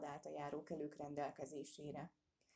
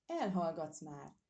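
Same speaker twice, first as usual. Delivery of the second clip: louder, slow gruff bark of a command; shouting at the dog; 5 dB louder, slower than usual. Acted. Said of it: "kiabált először a kutyájára".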